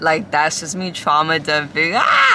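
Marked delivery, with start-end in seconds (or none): 2.0-2.3 sudden high-pitched "Ah!" of alarm; a sharp startled cry; startled high yelp. Real, not acted. Noticing the phone is overheating, a sudden startled yelp.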